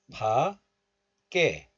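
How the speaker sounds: 밖에 split as open pa, then tense kke, slowly; liaison. Shown as a demonstration. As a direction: link 밖에 as pa-kke, drawn out. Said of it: "liaison or linking occurs".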